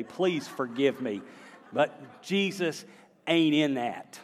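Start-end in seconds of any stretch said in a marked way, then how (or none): 1.7-4.2 "But Jesus" drawn out slowly, then "ain't in that" hit hard and louder; slow emphatic repeat. Shown as a demonstration. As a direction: hammer home "ain't in that" on the repeat. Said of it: "My grammar has been terrible today".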